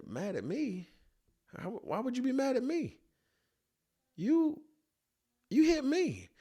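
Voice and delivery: protest, shocked he blames you; incredulous outrage. Retold or imitated. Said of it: "I said".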